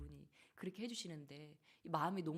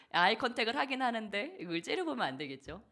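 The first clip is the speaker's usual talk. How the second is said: higher, louder pointed question; louder, teasing rebuke; emphatic; 6 semitones higher, 11 dB louder than usual. Real, not acted. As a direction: drive home the warning against glaring. Preacher exclaims about glaring.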